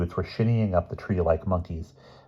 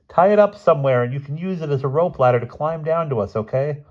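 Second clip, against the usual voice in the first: calling out; 8 semitones higher, 4 dB louder than usual. A narrator's reading. Calling up to the boy.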